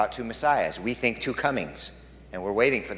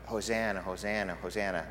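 hushed wondering echo; 3 semitones lower, 7 dB quieter than usual. Retold or imitated. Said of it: "them all shouting out".

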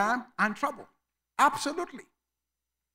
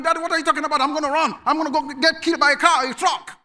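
lightly voiced stubborn kid, a bit higher and louder; defiant child; 6 semitones higher, 5 dB louder, faster than usual. Child arguing with his father, mock defiant kid voice. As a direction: talk back like a kid who won't listen. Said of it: "a child that keeps on going".

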